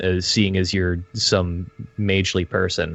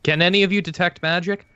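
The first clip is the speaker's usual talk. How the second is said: character question; 9 semitones higher, 3 dB louder than usual. Acted. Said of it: "I turn back ... and I ask".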